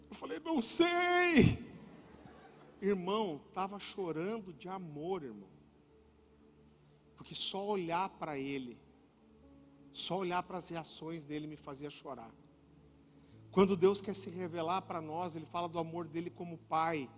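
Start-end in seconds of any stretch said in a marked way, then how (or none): 0.4-1.6 high, drawn-out tearful wail; mock crying. Husband answering wife why he is crying, exaggerated tearful wail. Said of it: "eu tava chorando desse jeito". 2.8-17.1 low, soft, heartfelt sermon voice; tender preaching. Real, not acted. Preacher telling congregation of a father's love, tender and hushed.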